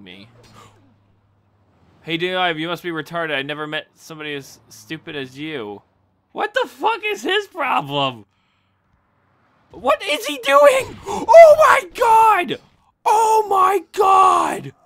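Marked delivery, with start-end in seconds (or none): 6.3-14.7 high, slow, loud cries of alarm; shocked shout. Real, not acted. Gamer shouting as an inmate goes wild. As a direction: yell in disbelief at the chaos.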